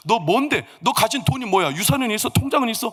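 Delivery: lightly acted, rising grilling; mock interrogation. Father-in-law grilling the penniless suitor, mildly indignant. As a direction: fire off questions with mounting pitch.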